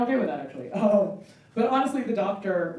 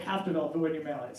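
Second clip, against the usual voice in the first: usual speaking voice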